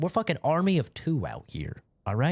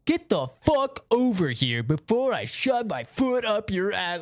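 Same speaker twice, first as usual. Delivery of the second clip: Oscar raging; 4 semitones higher, slower than usual. Retold or imitated. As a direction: drag out the insult like a toxic teammate. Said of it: "And Oscar's like".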